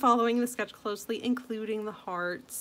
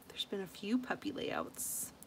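whispered aside; 9 dB quieter than usual. Real, not acted. Aside under her breath.

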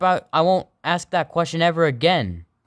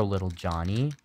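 usual speaking voice

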